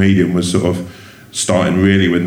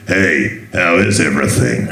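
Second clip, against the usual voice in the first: a lightly put-on bad American accent; half-hearted American accent from a tough guy; mock American accent, barely tried; 3 semitones lower, slower than usual. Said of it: "Do an American accent?".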